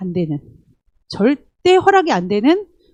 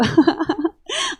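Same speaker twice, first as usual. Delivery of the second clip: high sneering giggle; mocking snicker; 5 semitones higher, 5 dB quieter than usual. Arrogant person snickers at others, a high sneering giggle. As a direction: snicker at them. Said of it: "희죽희죽거리고".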